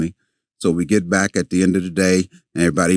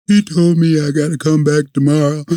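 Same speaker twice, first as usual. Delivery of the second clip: playing his younger self, lightly whining; upset kid; 8 semitones higher than usual. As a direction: pitch it up like a kid near tears. Retold boy complaining to grandma.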